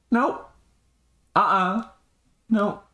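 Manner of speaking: short, high-pitched refusals; high little no after no; brisk refusals. Acted. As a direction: refuse each time in a quick high voice. Acted refusals after narration.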